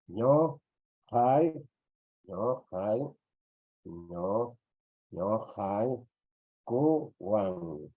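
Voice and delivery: "ño, hai, kuwan" in separate syllables, with pauses; teacher dictating "ño, hai, kuwan" in chunks; syllable-by-syllable demo. Shown as a demonstration. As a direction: sound out "ño", "hai", "kuwan" piece by piece. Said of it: "el sonido está bien así".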